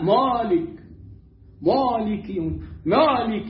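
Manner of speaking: مالك with a thick, dark, backed "aa"; wrongly heavy vowel. Shown as a demonstration. Teacher shows learners the mistake, over-heavy مالك. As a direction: make the alif in مالك heavy, like "mawlik". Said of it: "لماذا فخمتها؟".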